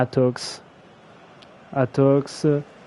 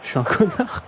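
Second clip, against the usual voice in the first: higher, mock self-scolding; 5 semitones higher than usual. Streamer teasing himself after botching a name, mock high voice. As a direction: call yourself an idiot in a silly voice.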